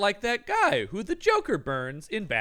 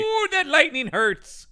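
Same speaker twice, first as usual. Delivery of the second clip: mock pain; 11 semitones higher, 4 dB louder, slower than usual. Voicing Polidori struck by lightning.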